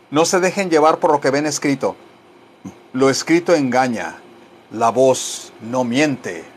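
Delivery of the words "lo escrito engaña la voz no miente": low, slow, measured maxim; solemn motto. Shown as a demonstration. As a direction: deliver it like a proverb, pausing.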